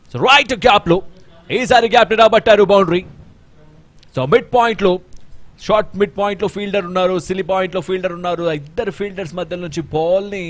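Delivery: high, loud boundary call; excited cricket commentary. Real, not acted. Boundary call, much higher and louder.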